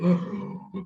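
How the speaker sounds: sharp, high intake of breath in awe; amazed gasp. Retold or imitated. Retelling the taxi ride with young founders, an amazed gasp. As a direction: gasp as if stunned by their brilliance.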